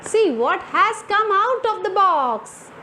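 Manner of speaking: usual speaking voice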